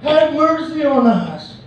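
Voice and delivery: high, slow, drawn-out appeal; acted cry, held on a high pitch; pleading shout. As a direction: call out for mercy, stretching each phrase. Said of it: "Mereka teriak lagi".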